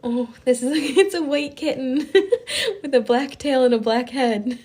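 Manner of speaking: laughing through the words. Real, not acted. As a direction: giggle while describing. Laughing while describing kitten.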